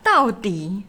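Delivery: high, slow, irritated outburst; fed-up, drawn-out complaint; exasperated rant. Real, not acted. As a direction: demand it in real annoyance. Ranting at online commenters.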